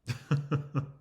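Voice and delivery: brief chuckle in quick pulses; short laugh. Real, not acted. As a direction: laugh briefly at your own question. Amused at own question.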